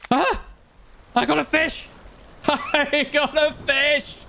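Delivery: high, laughing victory cry; triumphant. Real, not acted. Triumph catching fish.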